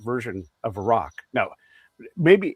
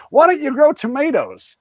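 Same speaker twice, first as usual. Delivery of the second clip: much higher and louder, mocking the cluelessness; mock naive American; 14 semitones higher, 7 dB louder than usual. Mocking Americans telling Iraqi farmers to grow tomatoes, high and loud. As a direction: parody a well-meaning, clueless outsider.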